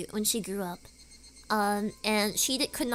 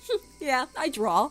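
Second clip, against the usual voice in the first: mock smug; 2 semitones higher, 3 dB louder than usual. Acting out showing off artwork.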